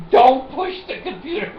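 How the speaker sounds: high, loud mock scold; mock nurse scolding. Mock emergency-room scolding.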